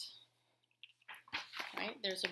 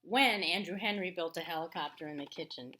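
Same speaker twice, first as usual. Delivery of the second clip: louder, measured read-aloud voice; story narration; 4 dB louder than usual. A narrator's reading. Teacher reading a picture book to her class, storytelling voice.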